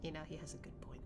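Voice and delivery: fast hushed whisper. Real, not acted. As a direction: whisper it as a quick aside. Quiet aside over cutscene.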